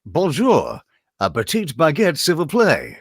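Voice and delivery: hammed-up French accent; mock French demo-reel voice. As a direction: do a silly stock French accent. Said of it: "And now a French accent".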